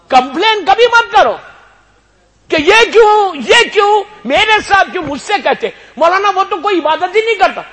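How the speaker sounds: high, loud impassioned sermon cry; full-force preaching. Acted. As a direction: preach it out to the whole hall.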